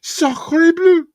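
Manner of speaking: put-on French accent, squealed very high; French director voice. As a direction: shriek it in a cartoon French accent. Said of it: "the French director".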